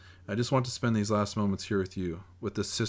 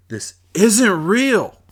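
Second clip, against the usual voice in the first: desperate scream, high and much louder; yell; 12 semitones higher, 13 dB louder, much slower than usual. A narrator's reading. Teen boy screaming at the TV news, full-voiced yell. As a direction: scream it in disbelief. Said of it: "I yell".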